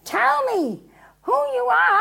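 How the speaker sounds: loud, high-pitched exasperation; exasperated demand. Acted. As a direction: demand it, patience gone. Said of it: "And exasperated, he goes again".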